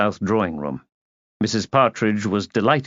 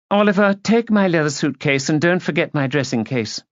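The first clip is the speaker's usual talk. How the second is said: higher-pitched bossy society lady; imperious lady; 6 semitones higher than usual. A narrator's reading. Lady giving her companion luggage orders, imperious. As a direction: order him about grandly.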